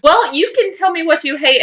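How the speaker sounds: ordinary talk, nothing marked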